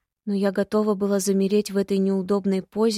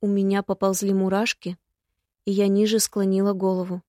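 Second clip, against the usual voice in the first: usual speaking voice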